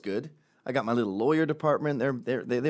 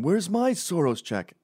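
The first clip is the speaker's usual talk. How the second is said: higher, slower whiny demand for a paycheck; mock Antifa protester; 8 semitones higher than usual. Antifa protester demanding his check, mock whine. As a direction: play a protester begging for his payout. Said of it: "you see Antifa going".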